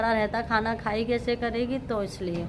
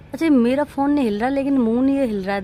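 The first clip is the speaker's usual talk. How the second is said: excited; 2 semitones higher, 7 dB louder than usual. Real, not acted. Excited about the moving moon.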